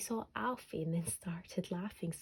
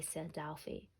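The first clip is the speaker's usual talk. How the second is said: ordinary delivery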